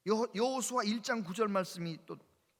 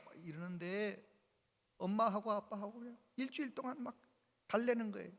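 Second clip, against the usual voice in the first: ordinary talk, nothing marked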